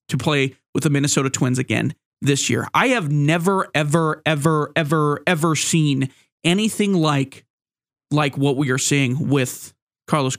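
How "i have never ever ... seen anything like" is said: each ever leaned on, slowing down; emphatic ever ever ever; stretched emphatic never ever. Real, not acted. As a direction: pile up the evers for disbelief.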